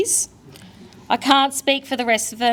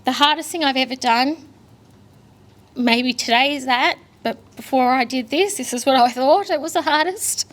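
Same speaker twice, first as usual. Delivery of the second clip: ordinary delivery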